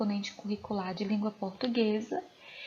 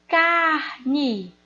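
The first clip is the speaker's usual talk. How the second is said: "Carne" loud and higher, split into CAR and NE; syllable-by-syllable demonstration; 4 semitones higher, 6 dB louder than usual. Shown as a demonstration. Teacher giving pupils the example "Carne" to find the tonic syllable, sounded out. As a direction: sound out "Carne" in two strong beats. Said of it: "Qual a sílaba tônica?".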